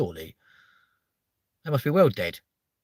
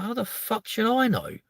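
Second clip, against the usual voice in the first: high-pitched, indignant comeback; exasperated retort; 12 semitones higher than usual. Retold or imitated. Retelling reply to ex about bubble bath, exasperated retort. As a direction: throw it back, voice pitched right up.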